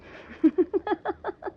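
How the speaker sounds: high mocking laugh at him; teasing laugh.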